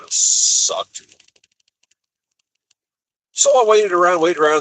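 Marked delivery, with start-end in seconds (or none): 3.3-4.6 higher, louder, fed up with the wait; irritated trucker venting; exasperated. Real, not acted. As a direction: stretch out the grumble, loud and high. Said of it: "complaining about waiting".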